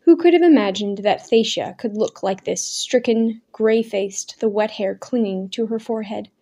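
plain reading voice

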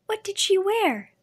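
put-on high, eager little girl; young girl voice. A narrator's reading. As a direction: ask it like a curious young girl. Girl's eager question.

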